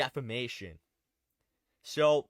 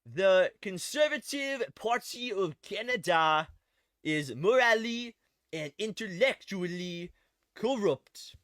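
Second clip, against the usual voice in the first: slow, put-on French-Canadian accent; Maxime Bernier accent; 3 semitones higher, slower than usual. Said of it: "I gotta say it in his accent".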